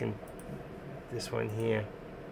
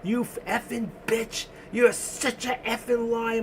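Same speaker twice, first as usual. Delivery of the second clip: imitating screaming wife; 10 semitones higher than usual. Husband mimics his wife screaming at the TV, high mock scream. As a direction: mimic an outraged scream in a much higher voice. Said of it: "I hear her in the room screaming".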